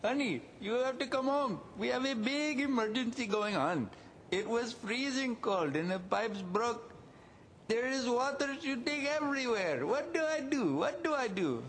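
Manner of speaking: high, panicked wife on the phone; frantic wife. Retold or imitated. Husband imitating his wife's panicked call about burst pipes. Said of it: "and she says".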